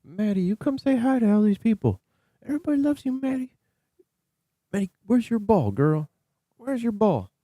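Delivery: high cooing voice for a beloved dog. Real, not acted. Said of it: "I'll just sit here and baby talk Maddie".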